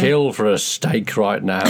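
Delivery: drawn-out "kill for a steak"; slow, hungry declaration; slow emphatic. Shown as a demonstration. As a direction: linger on each word like you're starving. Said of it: "why do you always say".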